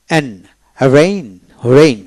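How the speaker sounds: ordinary talk, nothing marked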